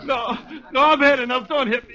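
cowed bully pleading for mercy; frightened begging. Acted. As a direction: beg him to stop hitting you.

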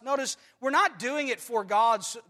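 usual speaking voice

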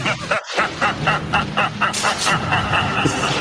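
high airy villain cackle; villain laugh. Pretend. Fan imitating the laughing villain trope, cackling.

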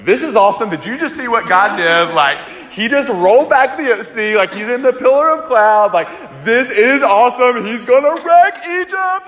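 high, loud, giddy cheering; a believer bursting with joy at the miracle; rising to a near squeal of delight by the end; thrilled Israelite. Acted. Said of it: "really faithful Israelites who just, like, went marching through".

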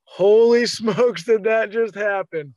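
can't believe what just happened; breathless laughing astonishment; stunned disbelief. Retold or imitated. Disbelief right after the shot.

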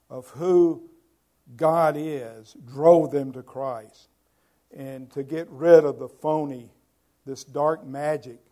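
loud, slow preaching; full-force preaching. Acted. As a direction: thunder it from the pulpit.

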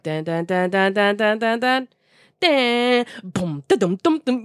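low hummed dun dun dun build into a boom; beatbox the DJ's track before the drop; imitated beat drop. Telling friends about the DJ set, mimicking the beat drop.

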